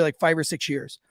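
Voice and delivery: ordinary talk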